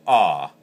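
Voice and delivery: loud voiced ah with a hum in it; voiced goose moan through a call; hummed goose moan. Shown as a demonstration. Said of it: "with your voice in it, humming".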